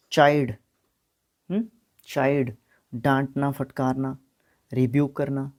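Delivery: ordinary talk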